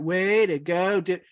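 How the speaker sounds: deliberately awful line reading; bad acting. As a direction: ham it up so it's unusable. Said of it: "I'm gonna give the worst version of it".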